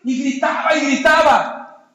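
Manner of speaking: loud, high, slow repetition. Real, not acted. Preacher tells how he kept crying out, loudly. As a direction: drive the repeated word home loudly.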